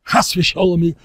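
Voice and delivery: loud scandalized exclamation; mock outrage. Speaker acting shocked at a joke, loud mock outrage. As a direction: cry out as if deeply offended. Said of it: "I say".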